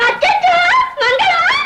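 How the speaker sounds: very high exclamation, drawn to a squeal; excited greeting. Acted. Visitor greets Mangalam, excited high exclamation. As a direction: cry out high on seeing them.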